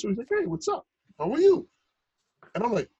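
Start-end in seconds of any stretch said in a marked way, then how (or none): 0.3-1.7 high, cheerful hello to a newcomer; sincere friendly greeting. Retold or imitated. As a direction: greet a new face with open friendliness. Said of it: "he meant it really".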